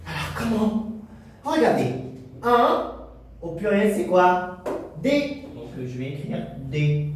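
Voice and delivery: ordinary talk, nothing marked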